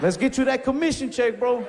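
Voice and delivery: high, hyped-up salesman cheer; mock hype. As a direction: pitch it high and hype him up. Over-the-top mock hype.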